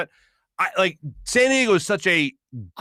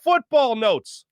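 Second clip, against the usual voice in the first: slow, higher drawn-out announcer call; segment announcer; 8 semitones higher, much slower than usual. Acted. Host opens the football segment, announcer style. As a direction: stretch it like a show intro.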